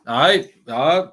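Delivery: put-on slow, half-hearted Welsh accent; badly faked touch of Welsh; attempted Welsh accent. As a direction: have a brief go at a Welsh accent, then give up. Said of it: "I can't do Welsh".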